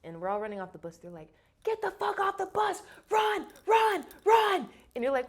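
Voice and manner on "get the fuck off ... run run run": high, loud boot camp yelling; shouted orders. Retold or imitated. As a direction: imitate instructors shouting recruits off a bus. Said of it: "we heard someone screaming at".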